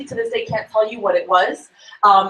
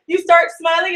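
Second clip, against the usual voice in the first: ordinary delivery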